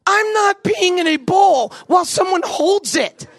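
high, slow, laughing shout of refusal; panicked shout. Retold or imitated. Teen boy refusing mom's bedpan, panicked high shout. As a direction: blurt it out half laughing in panic. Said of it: "I'm starting to panic now".

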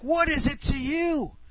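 high, slow, drawn-out pleading; acted-out incredulous arguing; exasperated protest. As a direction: protest in disbelief at an ally turning on you. Retold quarrel, exasperated pleading.

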